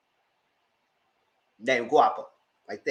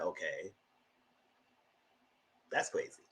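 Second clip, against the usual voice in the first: much softer, dropping to a whisper; deadpan; 10 semitones lower, 15 dB quieter than usual. Retold or imitated. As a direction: mutter it flatly, stunned. Deadpan reaction to scammer reveal.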